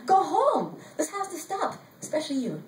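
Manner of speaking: high, half-held pretend scream; mock scream. A narrator's reading. Narrator telling other women this has to stop, mock scream. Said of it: "I wanted to scream".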